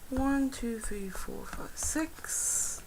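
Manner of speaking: softly whispered count. Acted. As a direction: count under your breath. Whispered counting while shuffling cards.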